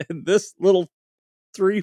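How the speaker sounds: usual speaking voice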